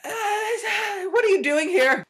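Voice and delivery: high, loud shriek of fright, then a shouted question; startled scream. Retold or imitated. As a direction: jump out of your skin and yell at the intruder. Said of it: "I turn behind me and I go".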